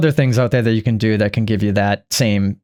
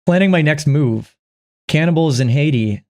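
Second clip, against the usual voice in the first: ordinary delivery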